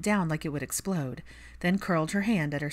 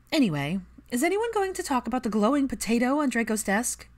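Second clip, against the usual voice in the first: put-on higher, louder teasing young woman; Pansy voice; 7 semitones higher, 4 dB louder than usual. A narrator's reading. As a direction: poke fun at the room.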